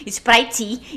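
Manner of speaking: "Sprite" as "is-prai-tchi", extra vowels at both ends; mispronounced Sprite. Shown as a demonstration. Showing friends that wrong "Sprite" still works, loud Brazilianized version. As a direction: punch out "Sprite" loudly with a final i. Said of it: "não importa se você falou Sprite".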